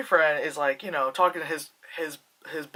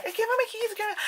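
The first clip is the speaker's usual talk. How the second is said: very high imitated girl's voice; high-pitched pretend fretting; mock panic; faster than usual. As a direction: act flustered, pitched way up. Acting out girl searching for keys.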